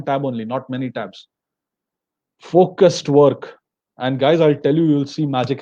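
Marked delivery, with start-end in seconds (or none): 2.4-3.6 "Focused work" spaced out slow and louder, weight on "focused"; slow emphasis. Real, not acted. Teacher summing up the rule for the class, slow and weighted. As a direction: plant the two words firmly.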